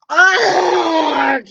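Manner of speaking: loud high pained yell; giant's yell. Acted. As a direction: cry out falling into a pit. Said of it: "yelled the giant again".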